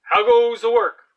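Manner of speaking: high, loud call from the lazy farmer; bossy farmer calling. Acted. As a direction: bellow it like a lazy boss from bed. Farmer voiced.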